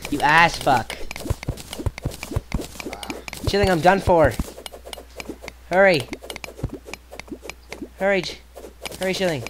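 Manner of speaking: frantic shouts, high and loud; panicked yelling. Real, not acted. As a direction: yell in real panic.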